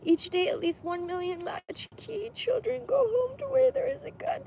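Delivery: high, tearful reading with breaking voice; real crying read aloud; crying while reading. Real, not acted. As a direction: push the words out through sobs. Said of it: "help me read this without crying".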